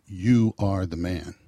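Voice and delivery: slow, loud, weight on "You"; a prophet's slow pointed verdict; slow solemn accusation. Retold or imitated. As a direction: lean hard on "You" and let "are the man" fall slowly. Prophet confronts David dramatically.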